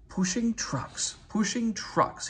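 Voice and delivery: high, slow sulky engine chant; sulky mutter. A narrator's reading. Henry sulking about pushing the trucks, high slow mutter. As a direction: grumble it like a put-upon engine. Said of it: "he muttered in a sulky sort of way".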